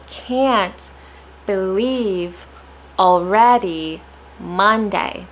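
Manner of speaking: "can't", "believe", "already", "Monday" isolated with pauses; stressed words. Shown as a demonstration. Teacher listing the words to stress, slow and emphatic. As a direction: punch each word separately, slowly. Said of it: "I'm placing stress on".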